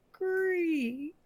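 creaky chair sound. Acted. Imitated chair creak in story.

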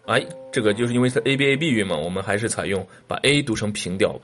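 ordinary talk

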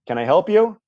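a husband checking the stranger at his door; guarded husband. Retold or imitated. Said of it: "the husband comes".

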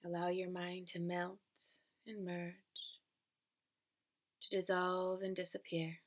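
calm, even relaxation-script delivery; guided meditation. Acted. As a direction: guide the listener gently into stillness.

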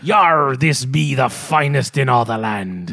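hammed-up pirate, slow and loud. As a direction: ham it up like a pirate captain. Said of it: "Doesn't talk like a pirate".

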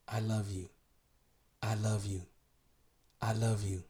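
hushed, low, gentle I love you, like a heartbeat; soft heartbeat whisper. Acted. God's heartbeat speaking to the listener, hushed and soft. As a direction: breathe it softly, low and close. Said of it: "you know what his heartbeat sounds like".

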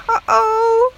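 very high, loud alarmed uh-oh; frightened cry. A narrator's reading. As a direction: cry out as the plane tips. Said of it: "cried Lettice".

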